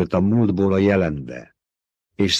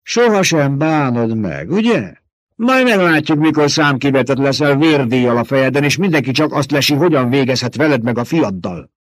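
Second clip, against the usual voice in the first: higher, louder taunting threat; menacing old mother superior; 7 semitones higher, 6 dB louder than usual. Acted. Old woman taunting Jessica about her exile. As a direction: taunt her with the coming hunt.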